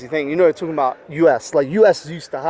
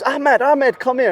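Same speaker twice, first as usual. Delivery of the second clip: high mock call across the room; lightly voiced housemate calling; 8 semitones higher, slower than usual. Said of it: "And he goes".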